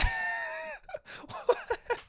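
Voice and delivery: high mocking laugh at a baby. Laughing at the crying baby, high mocking laugh. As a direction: laugh right in the baby's face. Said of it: "And then I went".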